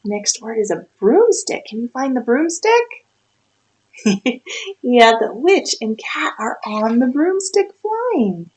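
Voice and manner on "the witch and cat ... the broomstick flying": usual speaking voice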